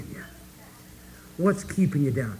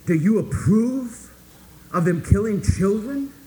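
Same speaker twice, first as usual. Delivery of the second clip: higher, louder, slow accusing challenge; accusing demonstrator; 5 semitones higher, 5 dB louder, slower than usual. Retold or imitated. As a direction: confront her like a self-righteous protester. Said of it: "the anti-war demonstrator ... said".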